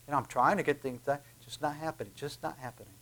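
quiet, weary mutter of a defeated person; quoting frustrated people. Retold or imitated. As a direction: sigh it out like someone giving up. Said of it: "Ever hear people saying".

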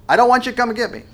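high, loud mock-panicked kid; imitated child crying out. Son refusing dad's help from the monkey bars, imitated crying out. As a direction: cry out like a scared son stuck up high. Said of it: "he starts crying out to me".

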